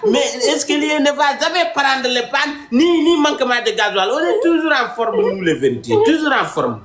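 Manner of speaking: high, rising mock sermon; mock preaching. A comic preacher proclaiming truth, in a high, fervent voice. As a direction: preach it like a revival, voice climbing.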